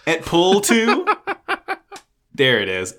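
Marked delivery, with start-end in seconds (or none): 0.1-1.1 mock dramatic. Pun on Brutus line.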